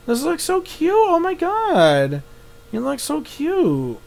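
high, soft, drawn-out gushing; adoring coo. Real, not acted. Player taken with a cute mouse, high soft coo. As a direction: melt over it in a high voice.

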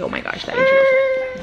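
squeaky high yelp at the heat; high yelp after tasting the hot chili; spicy squeal. Real, not acted. Burned tongue tasting chili.

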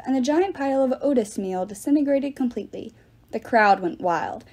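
usual speaking voice

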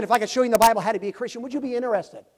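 fast eager sales pitch; rushed pitch. Retold or imitated. Preacher rushing the offer to the stranger. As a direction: rush it out eagerly.

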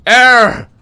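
high, loud repeated cry; loud alarm. Real, not acted. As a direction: call out the glitch in alarm. Screen glitches.

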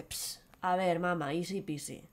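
low, soft offhand reply; lightly voiced cool son; casual shrug. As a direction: toss it off as nothing. Aladdin casually dismissive.

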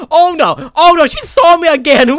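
high, loud panic; panicked shout. Real, not acted. Gamer caught by the owner, loud panic. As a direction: yelp as she spots you.

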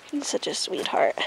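breathy fond whisper. Real, not acted. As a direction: whisper it tenderly to yourself. Whispering about the goat.

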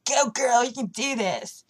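put-on rock star urging you on. Singer cheering someone exercising, punchy imitated encouragement. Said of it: "and [name]'s like".

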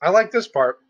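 muffled through hand; talking with mouth full; hand over mouth chewing. Real, not acted. Seen 1: Hand covers mouth after a bite, while chewing and talking.

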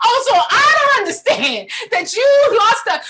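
loud, high mocking God; God's voice. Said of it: "And God was like".

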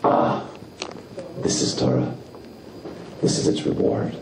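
low, hushed, near-whispered reading; quiet acceptance. Shown as a demonstration. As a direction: breathe it out as a settled truth. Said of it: "You have to change the inflection".

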